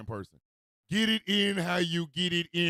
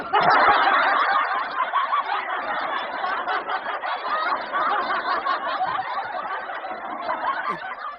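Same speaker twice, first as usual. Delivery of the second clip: loud high cackling; long helpless laugh; 7 dB louder than usual. Real, not acted. Host cracking up at his own joke, helpless laughter.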